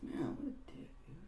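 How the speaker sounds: high, hushed, whispery complaint; muttered grumble. Retold or imitated. Girl refused a look at cards, whispered grumble. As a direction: grumble it under your breath.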